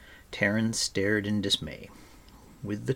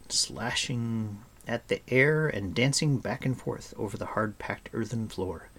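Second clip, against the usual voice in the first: ordinary delivery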